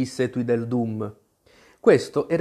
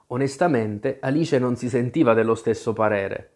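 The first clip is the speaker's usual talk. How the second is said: ordinary delivery, nothing marked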